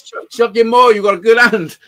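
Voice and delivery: loud, high goading jeer; drunken taunting. Retold or imitated. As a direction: egg them on like a rowdy drunk. Taunting card players while drunk.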